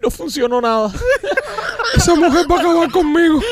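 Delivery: high-pitched mock Rolly voice, laughing through it; high comic voice for the weeping friend; quoting Rolly. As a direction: squeak out his sob story in a high put-on voice. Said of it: "Y dice".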